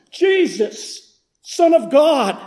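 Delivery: high, straining cry to be heard; loudest scream. Retold or imitated. Blind beggar crying out to the passing master, high and straining. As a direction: reach over the whole crowd with it. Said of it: "screamed as I could in the loudest voice".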